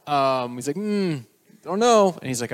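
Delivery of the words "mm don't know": slow, higher-pitched reluctance; reluctant. Acted. Angry worker balking at God's request, reluctant. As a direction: drag it out as a hammed-up hesitation.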